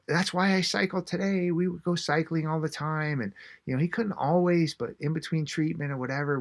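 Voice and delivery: imitated gushing, held high in pitch; gushing fondly. Daughter asked about her late dad, retold fond gushing.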